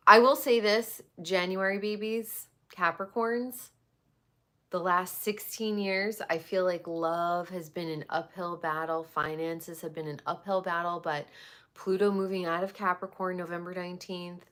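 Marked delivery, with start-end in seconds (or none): none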